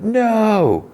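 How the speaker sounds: long, high-pitched dramatic wail; hammed-up horror, pitched far above normal talk; mock anguished cry. Marcy sees Joe zap Nibs, high mock wail.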